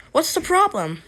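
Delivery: high-pitched acted question; questioning character voice. Josiah asks Mister Hobson what is wrong, questioning character voice.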